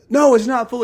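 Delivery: high, excited group shout; loud, high-pitched shouted reply; class shouting. Retold or imitated. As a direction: yell it like a whole class answering. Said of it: "And the class shouts".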